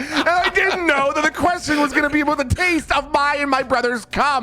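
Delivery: laughing. Real, not acted. Defending himself while laughing.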